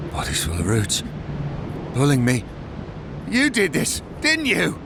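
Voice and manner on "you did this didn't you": high-pitched, wild accusation; acted accusation. Root-maddened speaker turning on the Envoy, high and accusing. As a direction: pitch leaps up as you accuse.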